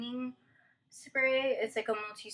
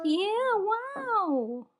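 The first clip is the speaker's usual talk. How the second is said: excited praise; 11 semitones higher, 6 dB louder than usual. Real, not acted. Praising toddler.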